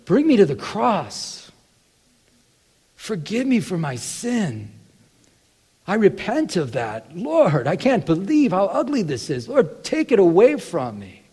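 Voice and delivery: slow, pleading prayer, lifted up to the Lord; sincere repentant prayer. Pretend. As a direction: pray it slowly as a confession. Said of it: "we should say".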